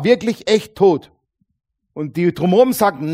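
loud, driving preacher voice; forceful preaching. Acted. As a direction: preach it out to the crowd. (mit voller Kraft gepredigt)

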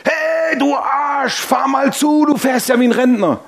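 high mock shout at the driver; brother shouting. Little brother yelling at the slow driver, mock shout. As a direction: bark it out like an impatient little brother. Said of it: "schreit er mich an".

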